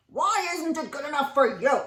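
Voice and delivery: loud challenging caveman voice; cavemen. Acted. Cavemen challenging Stanley, loud and gruff. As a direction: push back like a grumpy caveman.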